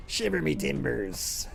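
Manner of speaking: slow, soft mock pirate accent; hushed, slowed-down pirate impression. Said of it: "like, pirate accent".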